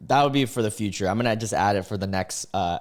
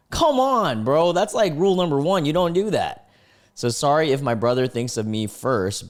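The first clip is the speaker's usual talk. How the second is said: usual speaking voice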